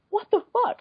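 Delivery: high, incredulous outburst; stunned what the fuck. Retold or imitated. Woman recalling her reply to the stranger, high incredulous outburst. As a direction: blurt it in disbelief. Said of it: "I think I just went".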